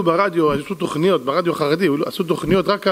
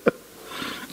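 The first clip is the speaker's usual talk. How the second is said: high laughing delivery; amazed laughter breaking the words; 3 dB quieter than usual. Real, not acted. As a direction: laugh through the punchline. Laughing retelling wonder.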